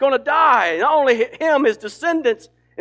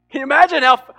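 normal talking voice

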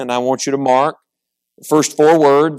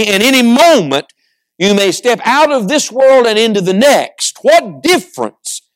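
higher-pitched, forceful pulpit urging; impassioned preaching; 4 semitones higher, 3 dB louder than usual. Real, not acted. Preacher warning of eternity's edge, impassioned and urgent preaching. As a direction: press each phrase on the congregation.

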